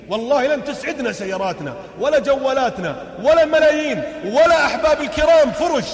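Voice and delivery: high, loud sermon cadence; fiery preaching. Acted. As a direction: preach it out to the crowd. Preaches at full force.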